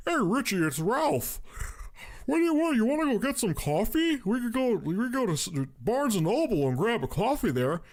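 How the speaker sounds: high, overly friendly stranger voice; creepy caller voice. Retold or imitated. Stalker caller inviting Richie for coffee, high and eerie. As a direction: coax him out for coffee, eerily sweet. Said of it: "who sounds like Gary Oldman".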